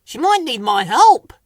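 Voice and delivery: high, loud, concerned voice; Whoops. A narrator's reading. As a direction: pipe up with concern. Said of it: "said Whoops".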